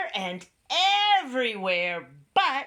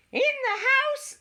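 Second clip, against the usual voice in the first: very high, louder squeak; squeaky mouse; 5 dB louder than usual. A narrator's reading. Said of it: "squeaked the mouse".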